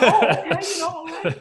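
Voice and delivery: high, laughing retort; laughing at being told to get out. Retold or imitated. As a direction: laugh it off in disbelief. Thrown out, laughing it off.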